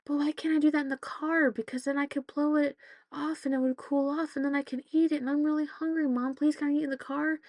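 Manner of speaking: a hint of a whiny pleading daughter; pleading kid. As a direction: beg like a hungry kid. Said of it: "And she goes".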